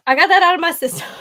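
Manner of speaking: real relieved laugh at yourself. Real, not acted. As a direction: laugh through the words. Laughs off her crying spell.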